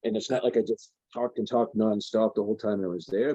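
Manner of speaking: ordinary delivery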